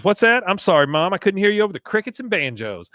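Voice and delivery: high, loud mock sarcasm; sarcastic Bobby. Bobby sassing his mom, high and loud. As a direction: needle her with fake politeness. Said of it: "Bobby says".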